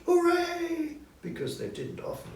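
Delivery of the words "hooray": high, loud cheer; children cheering. A narrator's reading. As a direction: shout it like happy kids at the table. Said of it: "all the children in the family shouted".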